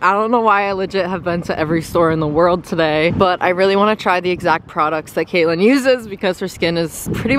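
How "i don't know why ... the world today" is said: ordinary talk, nothing marked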